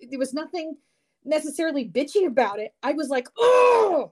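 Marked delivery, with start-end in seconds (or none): 3.4-4.1 startled outburst. Retold or imitated. Sudden outburst at a text.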